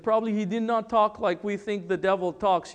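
ordinary talk, nothing marked

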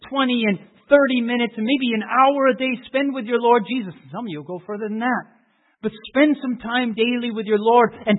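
loud, rising pulpit fervour; full-throated revival preaching; preaching at full force. Acted. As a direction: preach it out, building.

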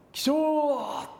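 a big high shout of 起床 across the mountain; wake-up shout. Retold or imitated. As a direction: bellow 起床 like the dorm teacher waking everyone. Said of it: "その先生が".

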